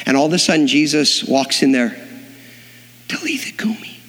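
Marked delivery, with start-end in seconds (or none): 3.1-4.0 soft breathy whisper; whispered Aramaic call to the girl; whispered command. Acted. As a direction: breathe it over the sleeping girl. Jesus raises the dead girl.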